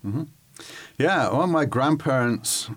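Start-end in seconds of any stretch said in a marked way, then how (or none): none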